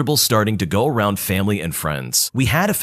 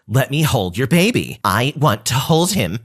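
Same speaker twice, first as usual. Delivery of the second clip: mock entitled cousin; 3 semitones higher than usual. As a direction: whine it like a spoiled relative. Cousin repeats her demand.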